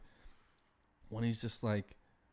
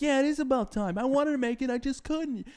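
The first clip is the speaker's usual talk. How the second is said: much higher and louder mock yell; high, loud Adam Sandler rage voice; Happy Gilmore yelling; 6 dB louder than usual. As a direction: bellow it like an over-the-top angry golfer. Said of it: "Happy Gilmore's already halfway up the hill".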